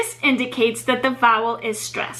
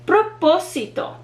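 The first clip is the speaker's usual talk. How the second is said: "Propósito" with PO higher and stressed; stress on third-to-last syllable; 5 semitones higher than usual. Shown as a demonstration. Teacher giving an example word, stress on PO in "Propósito". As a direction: punch the second syllable of "Propósito". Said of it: "third to last syllable is the one that receives the stress".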